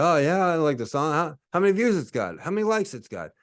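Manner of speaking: lightly voiced snide fan; a hint of a dismissive listener; mocking listeners.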